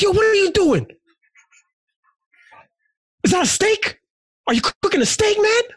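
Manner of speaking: high, loud yelled challenge; yelling at kid. Retold or imitated. Yelling at a kid messing with the barbecue, high yell. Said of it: "so I yelled at him".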